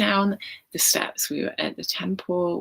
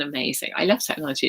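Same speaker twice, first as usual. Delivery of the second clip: ordinary talk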